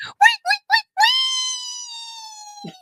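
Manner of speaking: high shouted wait wait; yelling wait. Acted.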